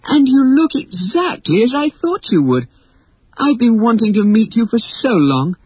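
high-pitched, gushing excitement; thrilled young girl's high voice; delighted. A narrator's reading. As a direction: gush to a long-awaited hero. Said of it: "she cried with delight".

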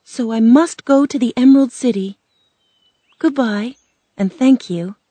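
brave. A narrator's reading. Girl taking leave for the Emerald City, bravely. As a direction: say it with plucky resolve. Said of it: "Dorothy said bravely".

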